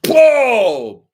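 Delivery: loud high startled cry; shocked whoa. Acted. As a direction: yelp out in shock. Shocked at the attack.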